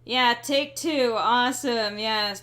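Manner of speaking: drawn-out, sarcastic fake enthusiasm; slow, sarcastic delight. Real, not acted. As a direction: drag out the mock cheer. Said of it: "Please pardon my sarcasm".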